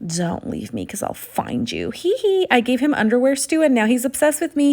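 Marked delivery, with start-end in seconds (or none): 0.0-1.9 much lower, quieter, slower menacing voice; creepy stalker. Pretend. Joking threat to husband watching, deep creepy voice. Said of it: "in a very creepy". 1.9-2.5 high mischievous little titter; creepy giggle. Pretend. After teasing her husband, high creepy giggle.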